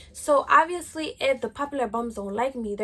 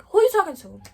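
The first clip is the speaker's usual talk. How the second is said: louder and higher, confronting her; loud angry challenge; 5 semitones higher, 8 dB louder than usual. Retold or imitated. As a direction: bark "Who you talking to?" back at her. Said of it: "I started getting fed up".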